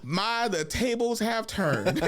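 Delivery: high, very slow theatrical gloat; mock villain gloating. Boy gloating over sunburned cousins, mock villain gloating. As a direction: savor it slowly like a hammy villain.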